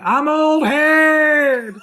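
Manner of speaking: high, drawn-out holler; mock shout. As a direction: yell it out and stretch every word. Shouting a catchphrase all night.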